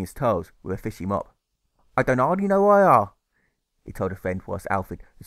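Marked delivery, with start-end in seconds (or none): 2.0-3.1 louder, higher rough-spoken fishmonger; a touch of a common shopkeeper. Acted. Fishmonger confiding to a friend, louder and higher.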